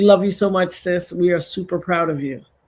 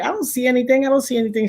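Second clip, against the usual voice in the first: higher, mock-anxious repeat; impatient retelling; 7 semitones higher, much faster than usual. Host retells waiting for Locke's picture, impatient. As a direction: act out hunting for a missing message.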